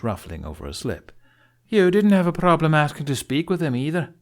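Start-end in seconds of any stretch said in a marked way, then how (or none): 1.7-4.1 higher, louder accusing jab; heated retort. Acted. Gobber firing back at Spitloud, loud and higher. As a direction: throw it back hard and loud.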